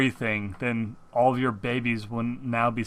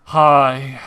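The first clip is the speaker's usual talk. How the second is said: droopy hello that blurts out louder on the last hi; lightly voiced glum friend greeting; a hint of a mopey caller saying hi; mopey Lightning Rabbit; 4 dB louder, much slower than usual. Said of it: "that is how Lightning Rabbit talks all the time".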